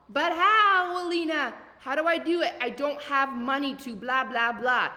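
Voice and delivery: mock whiny client, high and loud; a hammed-up excuse-maker whining at the coach. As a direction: imitate a complaining client pleading for help.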